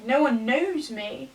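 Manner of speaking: ordinary talk, nothing marked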